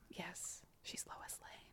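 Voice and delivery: barely audible whisper; very soft, voiceless aside. Real, not acted. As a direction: whisper the answer under your breath. Whispered answer under the debate.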